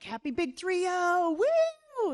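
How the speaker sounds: hammed-up birthday woo; cheery ex. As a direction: whoop it up.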